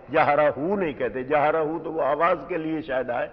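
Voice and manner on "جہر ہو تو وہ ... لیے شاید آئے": normal talking voice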